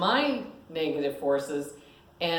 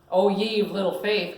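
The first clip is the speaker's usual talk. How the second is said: loud mock rebuke; 4 semitones higher, 7 dB louder than usual. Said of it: "called Madge".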